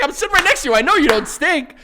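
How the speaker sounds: loud, high-pitched retelling; worked-up reassurance. Retold or imitated. Husband assuring wife beside him, loud and worked up. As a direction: insist excitedly over the uproar.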